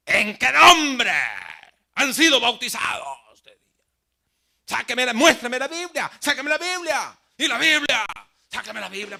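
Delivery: mock fanatic yelling for proof; shouted demand. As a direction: demand proof at full volume. Zealots demand Bible proof.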